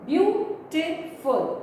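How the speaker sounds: Beautiful drawn out slowly, syllables split. Shown as a demonstration. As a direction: spell out Beautiful beat by beat. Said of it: "three syllables".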